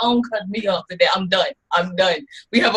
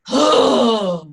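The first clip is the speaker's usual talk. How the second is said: big loud sigh; exasperated sigh; 5 dB louder than usual. Real, not acted. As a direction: heave a long, loud sigh. Exasperated sigh after being interrupted.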